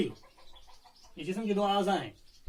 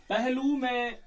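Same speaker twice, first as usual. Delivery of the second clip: slow, louder recital of the verse; poem recitation; 2 semitones higher, 6 dB louder, much slower than usual. Shown as a demonstration. Teacher reciting the verse to the class, slow and louder. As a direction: draw out each word of the line.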